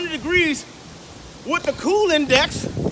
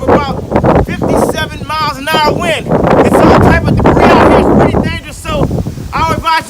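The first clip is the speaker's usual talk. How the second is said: shouting over wind; 10 dB louder than usual. Acted. Reporting in fake storm.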